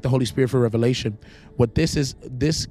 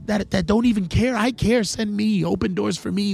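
much higher, pleading and impatient; young preacher begging; 9 semitones higher than usual. Retold or imitated. Young preacher pleading with God for his turn. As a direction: plead to be sent out. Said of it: "I was like".

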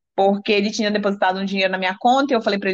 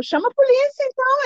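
retold defiance; 13 semitones higher than usual. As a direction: retell a challenge thrown back in a quarrel. Retold quarrel, daring him. (desafio recontado, em tom bem agudo)

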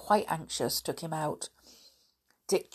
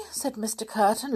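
ordinary delivery

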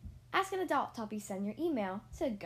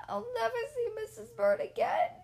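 much higher, tearful wail; crying; 11 semitones higher, 5 dB louder than usual. Acted. Mister Bird flying off in the rain, tearful high wail. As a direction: sob it out in despair. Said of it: "he cried".

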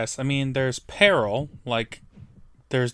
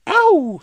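high, loud playful "ow"; joking high-pitched whoop; mock howl; 9 dB louder than usual. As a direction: let out a stretched, hammed-up howl. Joking howl after mock hype.

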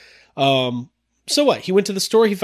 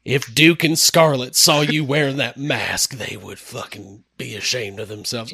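put-on Southern disapproval; Southern accent; 5 semitones lower, 3 dB quieter than usual. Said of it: "please read that in a Southern accent".

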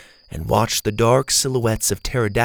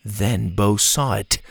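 plain reading voice

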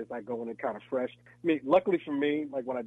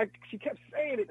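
normal talking voice

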